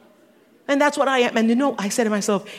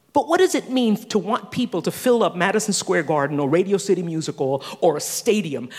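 normal talking voice

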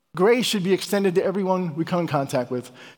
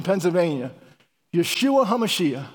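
usual speaking voice

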